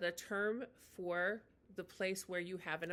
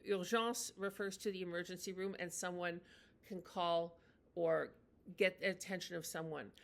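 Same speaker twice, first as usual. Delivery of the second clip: ordinary talk, nothing marked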